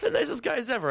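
high mocking sarcastic voice. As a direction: sneer it out in a high voice. Sarcastic quote about the guys.

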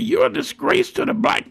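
high scolding granny voice; high-voiced scolding grandmother. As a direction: pitch it way up like the angry grandma. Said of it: "she said".

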